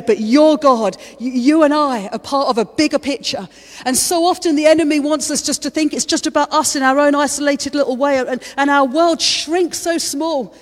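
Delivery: loud, high, rising preacher's voice; climbing passionate sermon delivery; full-force preaching. Acted. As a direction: preach it out to the room with fire.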